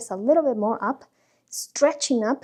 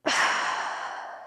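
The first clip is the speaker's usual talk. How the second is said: breathy release sigh; long sigh; 4 dB quieter than usual. Shown as a demonstration. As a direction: let it all out in one long sigh. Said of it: "as you exhale".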